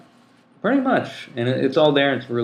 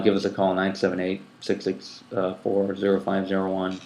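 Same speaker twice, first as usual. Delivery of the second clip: ordinary talk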